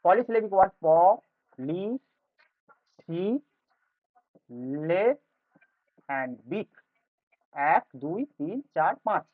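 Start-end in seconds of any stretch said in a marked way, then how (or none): 0.8-5.0 প, লি, সি, লে each alone, long pauses between; isolated syllables প, লি, সি, লে, spaced far apart; syllable division. Shown as a demonstration. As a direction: sound out প, লি, সি, লে slowly, syllable by syllable. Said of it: "syllable division নিয়ে আলোচনা".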